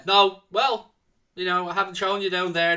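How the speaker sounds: usual speaking voice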